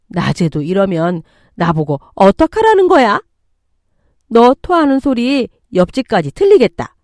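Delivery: scolding with loud, stressed peaks; angry mother-in-law. Acted. Mother-in-law scolding a pregnant daughter-in-law for retching, angry. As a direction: nag and berate, voice rising in irritation. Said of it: "화를 내기 시작했어요".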